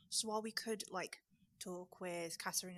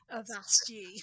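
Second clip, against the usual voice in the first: pirate voice; 9 dB louder, slower than usual. Pretend. Joking about pirate talk.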